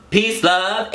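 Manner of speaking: high, drawn-out signature sign-off; sign-off catchphrase. Real, not acted. Vlogger leading viewers in his closing catchphrase, high sing-out. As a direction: call it out high for everyone to join in.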